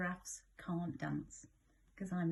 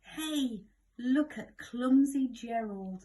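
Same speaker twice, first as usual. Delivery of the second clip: loud mocking jeer; jeering animals; 4 semitones higher, 10 dB louder than usual. Animals mocking Gerald on the dance floor, loud jeer. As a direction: taunt him from the crowd. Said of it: "the animals all laughed".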